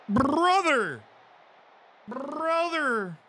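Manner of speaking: excited. Real, not acted. Celebrating, far high voice.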